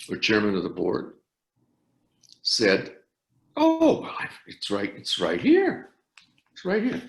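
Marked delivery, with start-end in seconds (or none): 3.5-7.0 high-pitched, eager "it's right here"; mock-eager aide, pitched high; imitating the aide. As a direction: pipe up brightly like a flustered aide. Retold chairman's aide answering.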